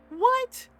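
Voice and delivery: high, loud rising squawk; imitated offended child. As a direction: act utterly affronted, voice shooting up. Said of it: "she was so offended".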